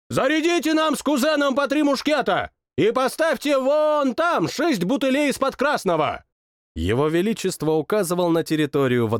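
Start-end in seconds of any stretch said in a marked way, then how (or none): 0.1-6.2 high, imperious order to servants; a spoiled monarch barking orders; commanding king. Acted. King orders servants to load muskets, high and imperious.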